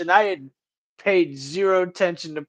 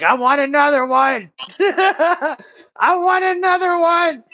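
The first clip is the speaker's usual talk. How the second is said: put-on high, loud, whining old lady demanding more pills; whiny grandma demand; 11 semitones higher, 6 dB louder than usual. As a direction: wail it like a feisty old grandma begging. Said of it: "she kept ... giving my mom shit, like".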